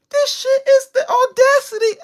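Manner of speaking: high, slow crying wail of disbelief; half-sobbing at the audacity; exasperated wail. Real, not acted. Ranting about IRS audacity.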